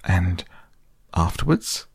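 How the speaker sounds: husky half-whisper rising at the end; a nervous little husky whisper; timid Piglet barely getting the words out. Acted. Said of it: "managed to say very huskily".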